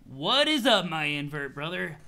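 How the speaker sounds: loud opening, then dropping low; hint of an easygoing stoner greeting; lightly voiced laid-back troll. Troll TC greets his best friend, lightly voiced laid-back troll.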